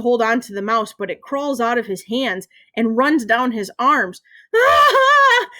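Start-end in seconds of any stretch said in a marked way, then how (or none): 4.5-5.5 loud, sustained high shriek; high yelled scream. A narrator's reading. Mouse runs down Ryan's arm, panicked yell. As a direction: scream as if something crawls on you. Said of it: "he yells".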